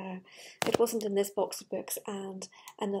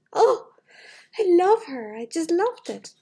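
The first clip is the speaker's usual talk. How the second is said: high, loud gushing swoon; swooning delight; 8 semitones higher, 11 dB louder than usual. Real, not acted. Grown woman swooning over her favourite princess, loud and high.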